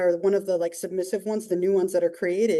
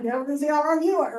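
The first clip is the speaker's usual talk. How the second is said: higher, fast mocking comeback; a therapist parroting her client's taunt, playfully mocking; mocking echo; 6 semitones higher, faster than usual. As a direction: throw her words back at her, teasing. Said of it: "And I was like".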